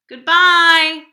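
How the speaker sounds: loud, high shouted goodbye; called goodbye. Acted. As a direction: call it out across the room. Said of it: "the students called".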